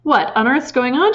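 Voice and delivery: high, loud alarmed cry; cried out. A narrator's reading. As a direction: call out in alarm. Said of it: "she cried".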